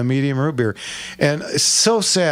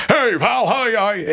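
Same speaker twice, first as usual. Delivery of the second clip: put-on higher, chirpy old-timer calling hiya; a higher, friendly old man saying hiya, hiya; old Charlie's greeting; 9 semitones higher than usual. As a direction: greet the kid like a cheery old regular at the window. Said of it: "here comes Charlie".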